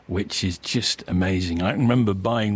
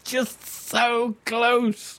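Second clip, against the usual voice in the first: amused; 12 semitones higher, much slower than usual. Real, not acted. Laughing after quiz blunder.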